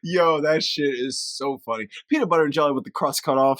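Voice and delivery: usual speaking voice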